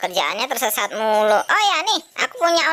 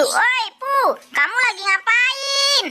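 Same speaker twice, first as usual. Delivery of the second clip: calling out; 10 semitones higher, slower than usual. Acted. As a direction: hail a friend from a distance. Calling to Depu from afar.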